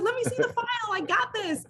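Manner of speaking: ready to take on the case, thrilled; eager excitement. Retold or imitated. Eager to join murder trial.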